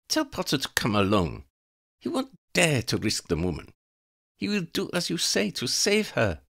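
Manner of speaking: high, cold voice of a female vampire plotting murder; scheming vampire woman. Acted. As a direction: savour each threat like a predator. Elena commands.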